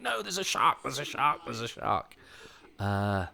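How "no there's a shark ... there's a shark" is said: high-pitched mock panic, easing as it repeats; panicked cry. Recalling a childhood swim for listeners, mock-panicked cry. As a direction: re-enact losing it in terror. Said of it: "I remember absolutely losing it and going".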